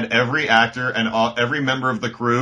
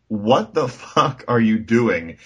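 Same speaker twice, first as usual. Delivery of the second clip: slow mock disbelief; 3 semitones lower, much slower than usual. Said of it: "be like".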